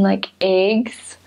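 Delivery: ordinary delivery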